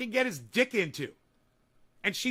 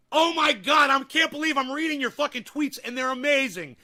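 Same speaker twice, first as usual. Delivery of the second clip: excited; 5 semitones higher, 5 dB louder than usual. Real, not acted. Thrilled reading viewer tweets.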